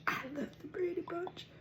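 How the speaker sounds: high whispered confession. Real, not acted. Whispering her love for the Brady Bunch. Answering own question.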